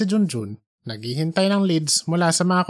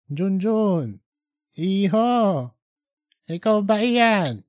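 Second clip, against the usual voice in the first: put-on old man's voice; 4 semitones higher, slower than usual. Old man calls Junjun.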